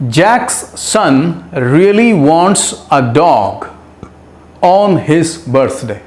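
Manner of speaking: very slow, word by word; slow dictation. Shown as a demonstration. Teacher reading the example sentence slowly to students. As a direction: read it out slowly for the class. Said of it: "एक और sentence देखते हैं".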